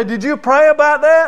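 loud, high pointed question to a member; preacher's question. Retold or imitated. Preacher quizzing a church member in a loud, high voice. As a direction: put the question to them hard.

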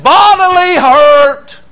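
high, slow, loud cry; preaching shout. Acted. Preacher crying out to the congregation about hell, loud and slow. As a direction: bellow it out as the peak of the sermon.

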